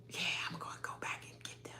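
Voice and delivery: fast, soft breathy imitation; whispered mock quote. Host mimics Brittany plotting, in a quick whisper. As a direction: rush it out under the breath. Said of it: "Brittany like".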